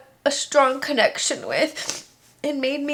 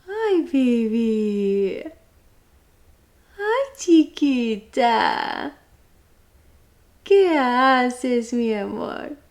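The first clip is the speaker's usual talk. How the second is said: cooing to cat; 3 dB louder, much slower than usual. Real, not acted.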